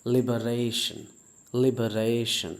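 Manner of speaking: लिबरेशन slow and careful, "li-buh-RAY-shun"; refined liberation. Shown as a demonstration. Teacher offering the better version: लिबरेशन, slowly. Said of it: "और अच्छा करना चाहें तो इसे ऐसे पढ़ सकते हैं".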